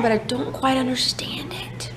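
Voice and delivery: soft whisper voicing the baby; whispered baby thoughts. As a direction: whisper what the baby thinks.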